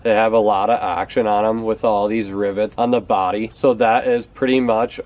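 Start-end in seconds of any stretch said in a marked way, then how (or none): none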